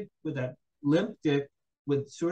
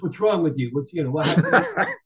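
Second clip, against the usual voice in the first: louder, mock-exasperated demand; retold exasperation; 2 semitones higher, 7 dB louder than usual. Girl scolding the fumbling storyteller, mock exasperated. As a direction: act out her fed-up outburst. Retelling girl's angry complaint.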